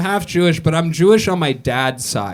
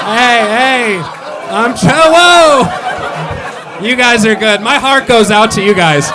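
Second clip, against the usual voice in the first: much louder, higher shouts over the crowd; real shouting at hecklers; 6 semitones higher, 7 dB louder, much slower than usual. Real, not acted. Comic shouting back at a heckling crowd, real and loud. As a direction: yell to calm the rowdy audience.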